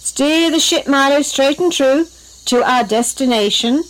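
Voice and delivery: commanding. A narrator's reading. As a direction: give the helm a storybook command. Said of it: "commanded Jared".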